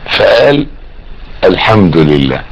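ordinary delivery

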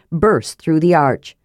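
ordinary delivery

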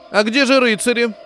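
high, loud whiny demand; capricious. Acted. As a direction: pout like a spoiled lady. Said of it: "капризно спросила одна из женщин".

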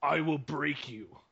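slow, drawn-out mock threat; gruff manly bruiser. Co-host imitates a gruff bruiser threatening Batman, slow and menacing. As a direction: play the hulking brute, word by word. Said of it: "gruff, manly, like".

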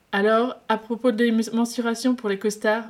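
ordinary delivery

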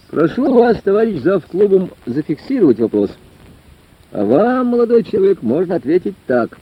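high-pitched, formal lecturer's address; official-minded lecturer. A narrator's reading. Lecturer replies to the young questioner, officially declamatory. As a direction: answer officiously from the rostrum.

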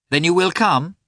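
Dolly calling after departing Karenin. Acted. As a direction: call after him as he leaves. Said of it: "cried Dolly".

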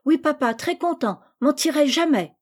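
put-on a tiny girl copying word for word; parroting little girl. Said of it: "c'est bien, Perroquette".

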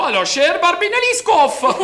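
slow, high, hammed-up Russian; Poliakov's fake Russian accent. Broke husband orders food as Poliakov, hammed-up Russian accent. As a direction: roll grandly through a badly faked Russian accent. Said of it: "Il prend l'accent russe".

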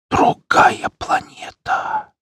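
slow, breathless whisper; fading whisper, nearly unconscious. Acted. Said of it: "шёпотом произнёс он". (шёпотом, угасая)

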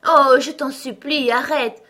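high, loud, imploring outcry; desperate cry. A narrator's reading. Queen begging weeping Alice to stop, desperate cry. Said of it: "s'écria la pauvre reine en se tordant les mains de désespoir".

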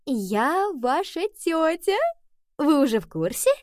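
high, drawn-out cooing announcement; high, stretched delighted voice; excited cat queen. A narrator's reading. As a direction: coo it to the kittens, pitch swooping up. Excited queen greets the kittens.

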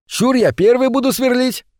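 high-pitched cry without much extra volume; eager cry. A narrator's reading. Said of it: "крикнул будущий староста кружка". (звонкий мальчишеский выкрик)

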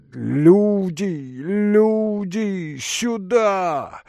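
high, stretched-out call; calling for help. Acted. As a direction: summon the servants in alarm. Зовут слуг на помощь. (протяжный зов на помощь)

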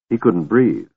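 ordinary delivery, nothing marked

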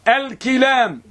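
الكلام with a short "i" after the k, al-kilaam; teacher's demo of الكلام with kaf as "ki"; kasra on kaf. Shown as a demonstration. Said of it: "وبالكسر".

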